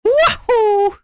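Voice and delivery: very high, strained Mario scream of agony; Mario burning alive noise. Acted. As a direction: shriek like Mario going up in flames. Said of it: "Was that a good Mario, like, burning alive noise".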